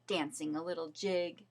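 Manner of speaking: ordinary delivery, nothing marked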